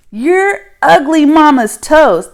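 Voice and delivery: high, loud mocking jeer. Kid taunting the others, high and loud. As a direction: fling it out like a playground taunt. Children trading insults.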